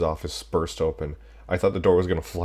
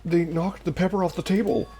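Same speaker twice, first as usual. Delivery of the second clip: excited character voice; 8 semitones higher, 3 dB louder than usual. Acted. Character explains, raised voice.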